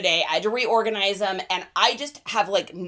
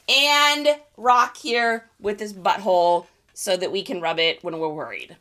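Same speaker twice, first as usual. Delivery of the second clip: ordinary talk, nothing marked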